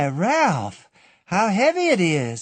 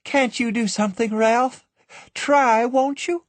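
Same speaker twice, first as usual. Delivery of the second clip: high, anguished plea; pleading young woman; 14 semitones higher than usual. A narrator's reading. Annabel begging Jimmy to save the child, anguished. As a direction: beg him to try.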